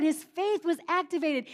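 usual speaking voice

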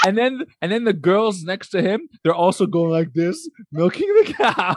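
high, laughing through words. Real, not acted. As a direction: squeal it while cracking up. Excited telling, laughing.

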